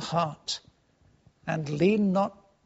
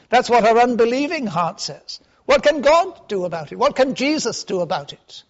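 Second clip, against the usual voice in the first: higher and louder, pressing sermon; fiery preaching; 6 semitones higher, 6 dB louder than usual. Real, not acted. Preacher challenging unbelief, raised and forceful. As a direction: drive the point home to the crowd.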